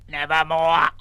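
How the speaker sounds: croaked like a raven; a bird rasping its one word. Acted. Raven croaking its word into the telephone.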